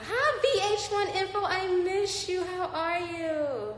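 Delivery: high, drawn-out excited hello; gushing greeting. Real, not acted. Host greets a missed viewer on live, gushing. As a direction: squeal a slow, delighted greeting.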